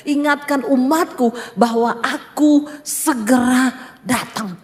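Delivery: low, slow, weighty divine voice; solemn voice of God. Retold or imitated. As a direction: speak as God giving a solemn warning, drawing out each word. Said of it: "waktu Tuhan ngomong face to face".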